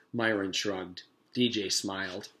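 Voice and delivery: usual speaking voice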